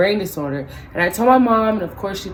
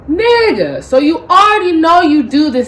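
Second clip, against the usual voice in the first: much higher and louder, incredulous; indignant callout; 8 semitones higher, 8 dB louder than usual. Retold or imitated. Woman calling out the chewer who admits his wife complains, outraged. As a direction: catch him out, outraged. Calls out stranger's admission.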